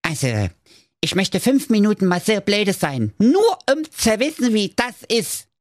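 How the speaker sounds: high squeaky dry sarcasm; mock him like a smug little agent; sarcastic dwarf. Dwarfs jeer at the fallen Justin, squeaky and sarcastic.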